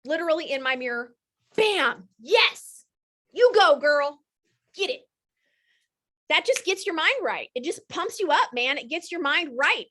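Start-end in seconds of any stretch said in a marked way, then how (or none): 1.5-5.0 high, loud self-cheer; hype yourself up. Shown as a demonstration. Coach showing her mirror high five, loud and excited. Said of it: "I high five myself".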